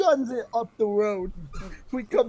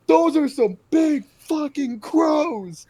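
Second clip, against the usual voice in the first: higher, louder and slow, mocking the kid; 7 semitones higher, 6 dB louder, slower than usual. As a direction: drag it out like the confused kid. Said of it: "points, and he goes".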